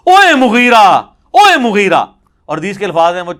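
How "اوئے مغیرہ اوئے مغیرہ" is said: loud, higher angry call of a name; angry rebuke. Retold or imitated. Companion angrily rebuking the governor for cursing, loud shout. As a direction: bark the name out in retold fury. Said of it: "غصے میں کہتے ہیں".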